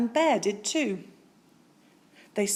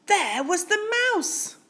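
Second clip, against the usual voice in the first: slow, higher dramatic reveal; surprise reveal; 12 semitones higher, 3 dB louder, slower than usual. A narrator's reading. Narrator reveals the mouse again, slow and higher. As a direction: draw out the surprise.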